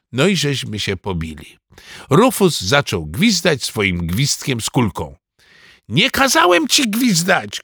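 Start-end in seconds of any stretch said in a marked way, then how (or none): 5.9-7.6 put-on high-pitched boyish protest; indignant cry. A narrator's reading. Ananiasz scolding Rufus for whistling, indignant cry. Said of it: "krzyknął Ananiasz".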